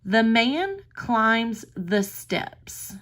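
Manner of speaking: put-on reading the sentence slowly to a young child; slow reading to a toddler. Shown as a demonstration. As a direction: read it out slowly for little kids. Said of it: "Let's look at this sentence".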